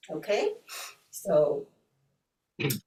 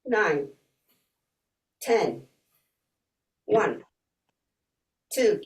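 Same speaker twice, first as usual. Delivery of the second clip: counting cues; 2 semitones higher, slower than usual. Shown as a demonstration. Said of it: "guide you the first round".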